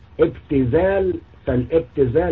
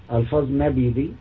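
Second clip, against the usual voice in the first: ordinary talk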